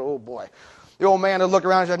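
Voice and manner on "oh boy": very low, hushed aside; quiet dread. Retold or imitated. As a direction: sink to a small, worried undertone. Young man dreading trouble.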